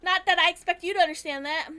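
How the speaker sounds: loud bitter snap; angry outburst. Acted. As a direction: throw it back at them loudly. Shotaro lashing out at friends.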